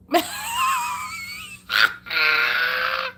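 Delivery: a big high cackle that breaks into a shout. Real, not acted. Laughing at own joke.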